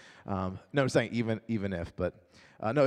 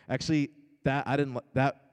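ordinary delivery

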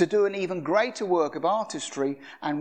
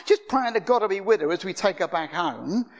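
ordinary talk

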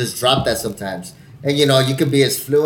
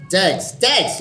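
Brad Pitt; 6 semitones higher than usual. Acted. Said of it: "you got all fucking Brad Pitt".

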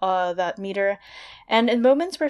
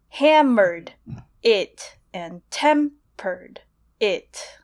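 hammered it and tempered it very slow, first syllables pounded; trochaic beat; 4 dB louder, much slower than usual. Shown as a demonstration. Host demonstrating trochaic meter for listeners, very slow. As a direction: tap out strong-weak, HAM-mered, TEM-pered. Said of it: "something that's stressed, unstressed".

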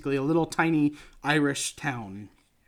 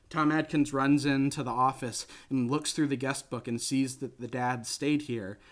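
normal talking voice

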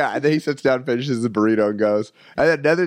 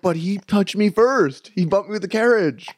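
high, stretched-out aggrieved excuse; mock indignant protest; 6 semitones higher than usual. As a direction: imitate a kid pleading his case. Brother pleading his case to police.

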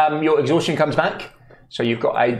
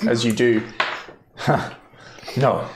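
usual speaking voice

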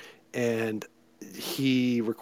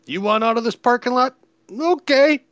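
excited; 11 semitones higher, 6 dB louder than usual. Retold or imitated. Quoting a movie character.

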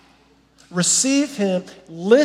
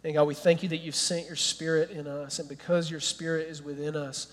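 prayer; 3 semitones lower, 6 dB quieter than usual. Acted. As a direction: pray it gently over the church. Pastor leads closing prayer.

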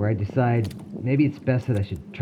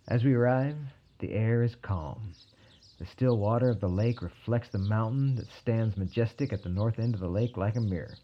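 plain reading voice

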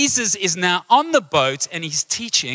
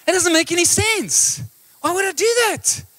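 high-pitched mock indignation; hammed-up disbelief, pitch leaping up; incredulous protest; 9 semitones higher than usual. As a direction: protest in a squeaky, baffled voice. Acted baffled reply to that advice.

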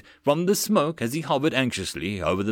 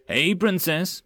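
teasing; 7 semitones higher, slower than usual. Acted. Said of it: "Mills teased".

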